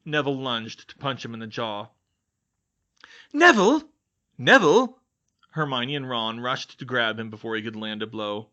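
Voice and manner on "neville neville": loud, high urgent call. Acted. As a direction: shout to stop him. Friends call out to stop him.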